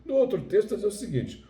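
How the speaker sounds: usual speaking voice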